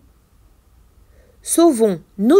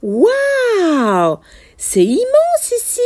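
drawn-out wow, then high-pitched wonder; acted amazement; 6 semitones higher, slower than usual. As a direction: marvel at a huge space. Character amazed inside whale's mouth.

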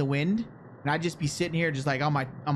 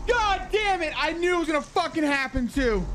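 scared horror game streamer; 12 semitones higher than usual. Real, not acted.

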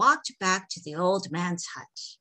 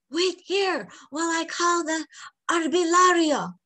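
frightened; 10 semitones higher, 3 dB louder than usual. A narrator's reading. Frightened boy rushing for help.

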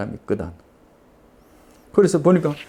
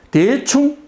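indignant echo; 12 semitones higher, 4 dB louder, much slower than usual. Retold or imitated. Old man bristles at the word.

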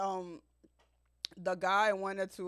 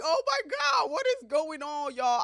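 high, loud squeal of confusion; a kid stunned by being bullied; shocked disbelief; 8 semitones higher, 5 dB louder than usual. Retold or imitated. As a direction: throw the voice way up in disbelief. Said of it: "I was like".

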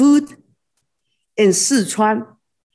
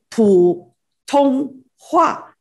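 普通话 spelt out slowly, each syllable apart; slow syllable demo; 5 semitones higher, much slower than usual. Shown as a demonstration. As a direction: count the syllables of 普通话 aloud. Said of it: "how many Chinese words? Three".

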